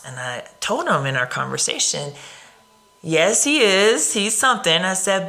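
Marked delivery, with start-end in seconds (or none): 3.0-4.9 amused emphatic. Retold or imitated. Agrees with husband.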